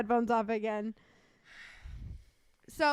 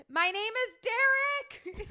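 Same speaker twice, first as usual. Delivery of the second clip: high, slow indignant yell; imitating brother yelling at the drive-thru worker; mock yell; 9 semitones higher, slower than usual. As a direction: shout the name over everyone. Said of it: "he's yelling over me".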